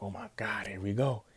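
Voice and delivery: rising, eager here-we-go chant; excited fan reaction. Retold or imitated. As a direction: hype yourself up watching the match. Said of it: "I'm sitting there on stream like".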